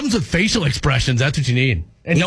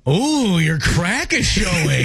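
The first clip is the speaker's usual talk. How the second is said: drawn-out mocking "ooh" and slow delivery; sarcastic; much slower than usual. Co-host attempts the sarcastic round, sarcastic. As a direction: stretch it out with sarcasm. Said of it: "You gotta be sarcastic about it".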